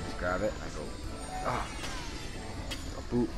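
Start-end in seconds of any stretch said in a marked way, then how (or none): none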